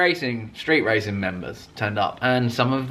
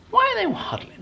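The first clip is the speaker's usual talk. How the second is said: baffled. Retold or imitated. Puzzled aside about the group.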